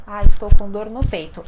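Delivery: loud, fast pretend complaint of chest pain; mock pain. Patient telling a doctor about chest pain, mock pained. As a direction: groan it out as if your chest hurts. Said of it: "né?".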